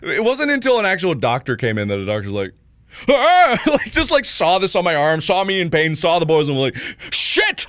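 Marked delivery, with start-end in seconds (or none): 3.1-3.6 high, loud mock gasp-cry; horrified yelp. Doctor seeing the boils, high horrified yelp. 7.1-7.7 high, alarmed mock exclamation; panicked curse. Doctor seeing the boils, high panicked curse. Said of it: "I remember the panic on her face".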